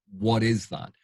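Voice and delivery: ordinary delivery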